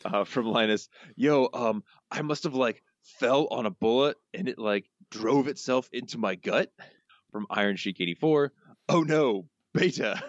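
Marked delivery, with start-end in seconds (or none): 1.2-6.7 higher, drawn-out cartoon-crook voice; Snake. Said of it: "your best snake voice". 8.9-10.2 higher cartoon-crook exclamation; Snake. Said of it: "your best snake voice".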